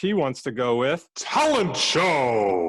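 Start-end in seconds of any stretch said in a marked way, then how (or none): 1.1-2.7 booming, drawn-out announcer shout; stretched-out showman's holler; mock game-show announcement. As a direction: belt it out like a game-show host. Host announces the chosen column.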